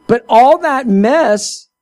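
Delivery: ordinary talk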